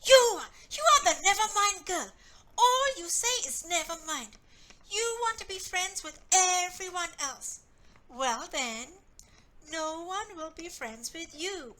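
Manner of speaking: exploding. Acted. Joe exploding at Anna, angry shout. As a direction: blow up at her. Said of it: "Joe exploded".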